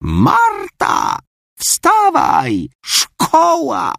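high yelling grandma; roaring. Acted. Grandmother yells up to sleepy Marta, high bellow. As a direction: bellow her up out of bed. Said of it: "zaraz ryknęła".

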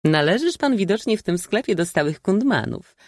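high-pitched teasing retort; amused old maid. A narrator's reading. Old maid mocking the cynical baron, amused. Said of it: "zaśmiała się stara panna".

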